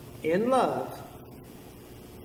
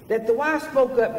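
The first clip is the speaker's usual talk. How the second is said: ordinary delivery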